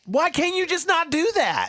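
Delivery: high, drawn-out pleading complaint; exasperated. Real, not acted. Co-host begging him to stop, exasperated.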